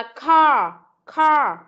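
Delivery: car said clearly, hard c sound; teacher's model car. Shown as a demonstration. Teacher modelling a word for the pupil, clear demo. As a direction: model "car" slowly for the child. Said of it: "Chữ này thì con đọc là".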